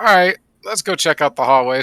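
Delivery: mildly hammed-up eager call; keen shout to the group. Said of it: "So we're like".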